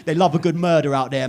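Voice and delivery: normal talking voice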